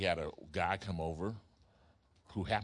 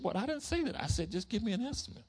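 much higher, indignant protest; upset protest; 13 semitones higher than usual. Retold or imitated. Preacher arguing with tree worker, high indignant protest. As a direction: insist you never agreed.

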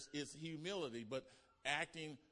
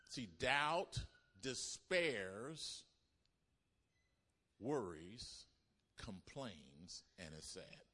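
sinking into sadness; 3 semitones lower, much slower than usual. Acted. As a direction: let each word drop lower and fade. Preaching doubt's gloom.

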